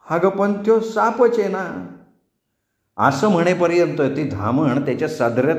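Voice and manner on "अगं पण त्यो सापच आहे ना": put-on higher childish protest, slow; lightly voiced frightened boy; scared boy. A narrator's reading. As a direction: insist it's a snake.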